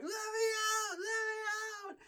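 very high, wailing crying plea; imitated crying child. Terrified child pleading to be let out, high crying shout. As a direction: wail it like a terrified five-year-old. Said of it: "banging on the door".

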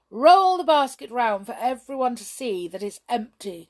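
loud, showy command. A narrator's reading. Hop commanding Jump before the audience, loud. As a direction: play to the crowd. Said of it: "commanded Hop".

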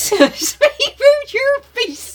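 very high, slow squeaky repeat; squeaky mocking echo. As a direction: parrot the threat in a silly squeak. Echoing the cockney threat, squeaky.

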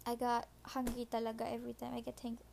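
usual speaking voice

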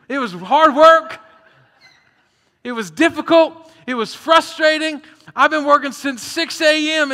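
loud, high, indignant worker griping; frustrated complaint. Retold or imitated. Worker complains to landowner, loud and indignant. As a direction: protest the unfair pay at full voice.

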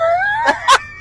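a real, high burst of laughter; high laughing squeal. Real, not acted. Laughing at herself for falling backwards, high squealing laugh.

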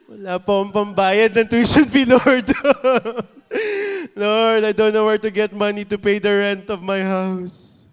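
loud, high mock-desperate begging through laughter; laughing desperate plea. Shown as a demonstration. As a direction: beg God half laughing, half pleading. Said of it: "that's how real it is".

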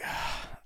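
long unvoiced sigh of frustration; weary sigh. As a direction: let the air out, giving up the fight. Frustrated, holding himself back.

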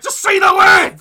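high, drawn-out full shout; exasperated shout. Acted. Viewer fed up with the slow presenter, exasperated yell. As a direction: yell it, at the end of your patience.

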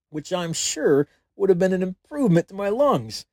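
ordinary delivery

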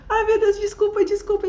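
high, loud tearful pleading; crying apology. Retold or imitated. Apologising to the father on the phone, in tears. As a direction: beg forgiveness through tears.

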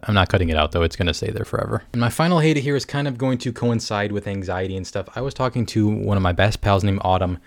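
ordinary delivery

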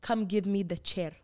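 imitating a thick Habesha accent in English. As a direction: put on a Habesha accent, as in childhood. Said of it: "start speaking in an accent, like a thick Habesha accent".

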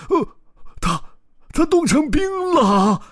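high, loud stammering shock; astonished cry. Acted. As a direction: gasp it out in disbelief. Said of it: "一头大象惊讶地喊着".

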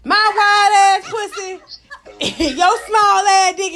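slow, laughing, half-shouted taunt; laughing mock shout. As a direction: cackle through the insult. Mocking a lover's body.

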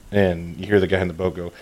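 normal talking voice

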